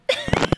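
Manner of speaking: sudden squealing shriek; high-pitched shriek. Real, not acted. Streamer caught by horror game, high shriek.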